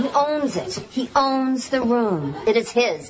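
slow, stressed owns, calm and sure; owning the room. Shown as a demonstration. Actress coaching the new actor to sound confident. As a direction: say it like the room is already yours. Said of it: "Try it again".

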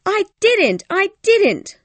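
very high, loud, frantic denial; crying denial. A narrator's reading. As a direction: deny it over and over in a frenzy. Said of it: "she cried".

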